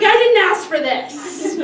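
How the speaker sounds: loud, high mock outrage; retold indignant sister. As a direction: protest loudly, imitating a fed-up kid. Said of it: "She's pissed".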